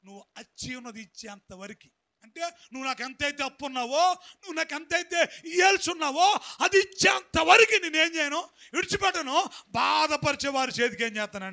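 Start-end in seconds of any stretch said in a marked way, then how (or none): 2.4-11.5 rising louder and higher to a shout; full-force preaching. Real, not acted. Preacher warning the congregation about not forgiving, building to a shout. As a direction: drive the warning home.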